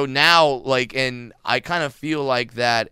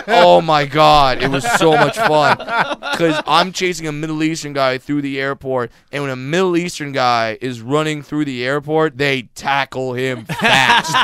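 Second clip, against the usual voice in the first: words broken up by real laughter; cracking up mid-story; giggling through the punchline; laughing while telling; 2 semitones higher, 6 dB louder than usual. Real, not acted.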